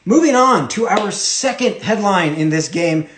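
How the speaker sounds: normal talking voice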